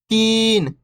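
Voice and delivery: long, stretched "तीन"; "तीन" with a sustained long ई; drawn-out vowel. Shown as a demonstration. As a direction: hold the ee in "तीन". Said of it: "इसी प्रकार से पढ़ना आपको".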